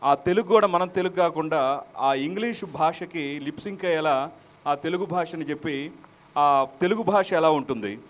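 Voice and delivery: usual speaking voice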